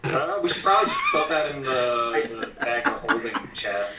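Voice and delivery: laughing while talking; giggly, half-laughing words. Real, not acted. Joking suggestion amid group laughter.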